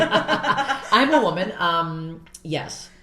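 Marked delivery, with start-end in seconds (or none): 0.0-1.5 loud, drawn-out laughing declaration. Real, not acted. Comic joking about being a woman, laughing. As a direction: say it high through a big laugh.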